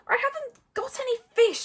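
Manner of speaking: high-pitched protest; high and exasperated; Hiccup speaking Dragonese. A narrator's reading. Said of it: "said Hiccup in Dragonese".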